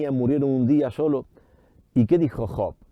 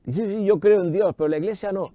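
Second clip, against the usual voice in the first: higher-pitched voice of a protesting believer; mimicked objection; 8 semitones higher than usual. Preacher mimics a believer who rejects the Church, mock objecting.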